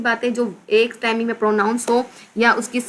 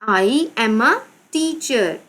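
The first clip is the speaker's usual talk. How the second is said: halting word-by-word read with pauses; slow dictation; much slower than usual. Shown as a demonstration. Teacher writing out the sentence for pupils, slow and deliberate. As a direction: dictate it slowly as you write.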